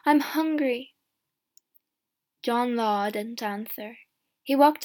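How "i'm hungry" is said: higher, louder plea; calling. A narrator's reading. Hungry girl begging from a peddler, calling. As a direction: call it after him pleadingly. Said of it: "Alison called".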